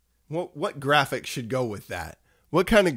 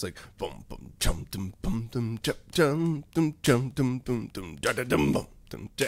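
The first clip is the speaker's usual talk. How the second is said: scatting a bass line; 4 dB quieter than usual. Shown as a demonstration. Explaining band timing to viewers, scatted bass phrase. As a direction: boom and dum sung softly as a bass phrase. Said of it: "you're playing a phrase".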